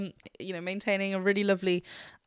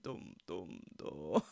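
dum dum dropped very low and much softer, laughing; very low bass attempt; 12 dB quieter, much slower than usual. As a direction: dig down for the lowest bass notes and crack up. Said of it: "I can't do it".

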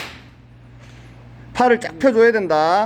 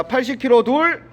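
ordinary delivery

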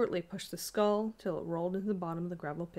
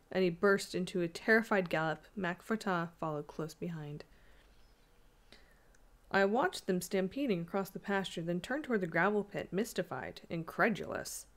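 usual speaking voice

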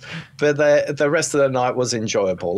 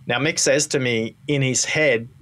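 normal talking voice

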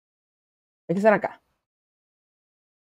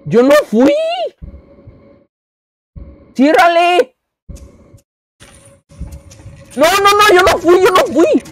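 high, loud frantic denial; panicked shouting; 8 semitones higher, 10 dB louder than usual. Real, not acted. Player caught by the monster, shouting in panic. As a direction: yell it out in real panic.

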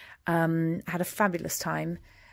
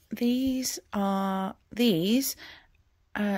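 ordinary talk, nothing marked

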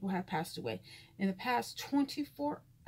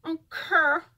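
put-on Memphis accent; 6 semitones higher, 7 dB louder than usual. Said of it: "That's how people in Memphis talk".

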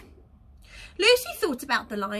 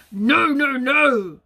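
loud, firm lion no; thundering lion; 7 dB louder, slower than usual. Acted. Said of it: "thundered the lion".

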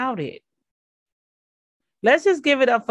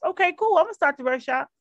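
mock eager yes; 6 semitones higher than usual. Acting out eager reply to God.

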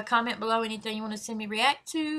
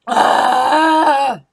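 excited shout; 3 semitones higher, 14 dB louder than usual. Real, not acted. Loud excited exclamation.

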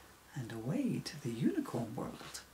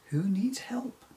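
higher, louder searching call; 7 semitones higher, 5 dB louder than usual. Acted. Emily calls from her hovering carpet to whoever cried, calling out. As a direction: call out to someone unseen. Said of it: "she calls out".

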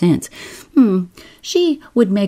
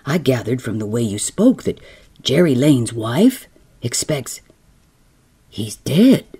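low man's voice; 5 semitones lower than usual. Acted. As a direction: lower skipper voice, quick. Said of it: "says he".

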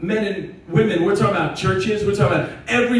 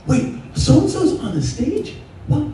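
higher, amazed gossiping voice, mock surprise; surprised gossip; 3 semitones higher, 3 dB quieter than usual. Churchgoers gossiping to the pastor, mock surprised. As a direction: gasp at the news like a churchgoer. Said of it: "people come up like".

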